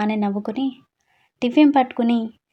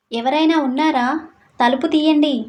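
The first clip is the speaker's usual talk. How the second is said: raised, higher-pitched call; 5 semitones higher, 4 dB louder than usual. A narrator's reading. Shivani shouts for someone to open the door, calling out. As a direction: call out to be let in. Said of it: "అని అరిచింది".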